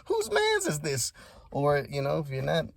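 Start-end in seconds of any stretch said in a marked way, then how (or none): 0.1-1.1 squeaky-high incredulous question with a laugh; hammed-up can't-believe-it voice, very high; mock disbelief. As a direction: pitch it way up, baffled and amused. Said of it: "We're like".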